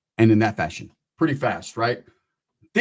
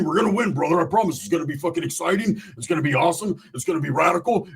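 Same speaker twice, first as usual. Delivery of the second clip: Roach's pitch; 4 semitones higher than usual. Retold or imitated. Roach talking the crew into pooling money, high and fast. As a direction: sell it hard like a pumped-up con man.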